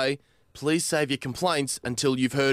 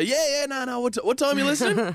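fake agreeable; 10 semitones higher, faster than usual. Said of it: "I lie to 'em and say".

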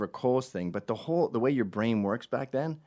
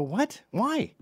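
shocked; 13 semitones higher than usual. Retold or imitated. Shocked reaction.

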